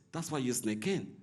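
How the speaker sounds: ordinary delivery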